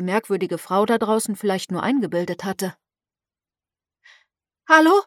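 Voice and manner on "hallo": loud, high call against the wind. A narrator's reading. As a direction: shout across the harbour. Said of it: "rief sie". (laut rufen gegen den Sturm)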